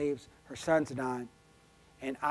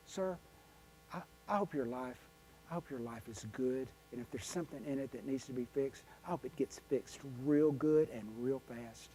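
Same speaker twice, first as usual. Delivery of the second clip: soft, tender well-wishing; kind word; 9 dB quieter than usual. Acted. Speaker offers a stranger a kind word, softly sincere. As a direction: say it quietly and sincerely, as a gentle blessing. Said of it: "Just a kind word".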